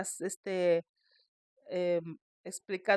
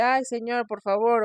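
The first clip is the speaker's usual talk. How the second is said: dismissive; 4 semitones higher, 7 dB louder than usual. Retold or imitated. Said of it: "pues le dice".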